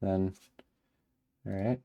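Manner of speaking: ordinary talk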